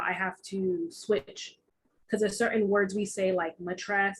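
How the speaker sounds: usual speaking voice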